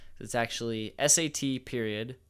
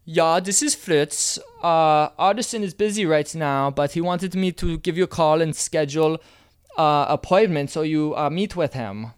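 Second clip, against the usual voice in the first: German accent; 5 semitones higher, 11 dB louder than usual. Shown as a demonstration. Dating coach voicing Fritz the assistant: loud, hammy German accent.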